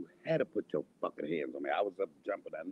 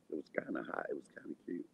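unvoiced, teasing whisper; whispered aside; 5 semitones lower, 9 dB quieter than usual. Real, not acted. Whispering a sly aside to viewers. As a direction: whisper it like a guilty secret.

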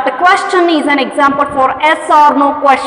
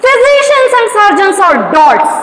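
angry king; 6 semitones higher than usual. Acted. King crying out at his physicians, in mock anger. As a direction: let the king snap at his useless doctors. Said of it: "cried the king".

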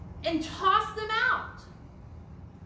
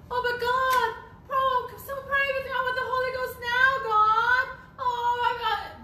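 mock frantic pleading; 7 semitones higher, 3 dB louder than usual. Late sinner begging God.

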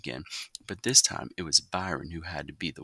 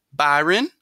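sharp call of his name, higher and louder; Mama calling; 10 semitones higher, 9 dB louder than usual. A narrator's reading. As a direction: call him out like a stern mother. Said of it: "Mama said".

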